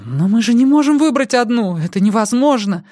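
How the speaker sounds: high, loud panicky protest; panicked protest. A narrator's reading. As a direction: protest in rising desperation. Panicked argument over impossible choice.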